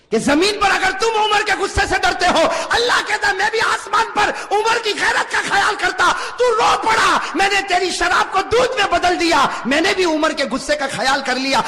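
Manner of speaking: high, loud impassioned preaching; preaching at full force. Real, not acted. Preacher at the pulpit, loud and impassioned. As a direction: fire up the congregation.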